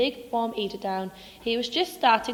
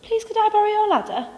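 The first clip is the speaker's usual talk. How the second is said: small, pleading and higher; timid mole voice; 6 semitones higher, 3 dB louder than usual. Acted. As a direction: ask nervously, rising to a plea.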